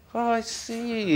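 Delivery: very high, slow, drawn-out realisation; mock dawning realisation. Writer recalling what he learned from pageants, exaggerated realisation. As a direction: stretch it out as the penny drops. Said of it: "it got through to me".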